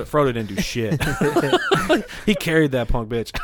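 ordinary talk, nothing marked

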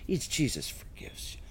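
tempting, breathy mock whisper of a lax preacher. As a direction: hush it like a sly secret offer. Mocking lenient church voices.

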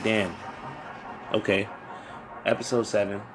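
murmured inner thought. Retold or imitated. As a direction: muse to yourself under your breath. Quoting own thoughts quietly.

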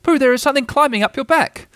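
high, urgent little voice; high-pitched alarmed warning; cried out. A narrator's reading. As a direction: raise the alarm, pitched well up. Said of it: "he cried".

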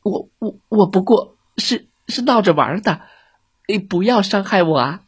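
high, stuttering frightened plea; stammering. A narrator's reading. Scared fox stammering and begging Billy not to hurt him. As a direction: trip over the words begging for mercy. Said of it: "狐狸结结巴巴地说".

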